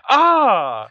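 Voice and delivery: sudden high yelp of a shot man; a short hammed-up scream; mock pained cry. Retelling the throat shot with a high yelp.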